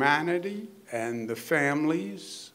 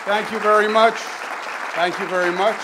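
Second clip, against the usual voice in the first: raised, loud thanks to a crowd; calling over applause; 6 semitones higher, 3 dB louder than usual. Real, not acted.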